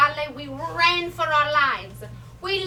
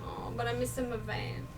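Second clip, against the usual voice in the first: put-on soft, wistful Italian-accented grandmother; wistful lament; 10 dB quieter than usual. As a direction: drop to a quiet lament for what is lost. Mourns her lost van and freedom.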